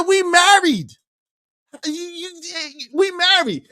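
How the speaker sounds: high-pitched outrage at his wife; outraged yell. Retold or imitated. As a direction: yell it like it's obvious. Shouting at wife.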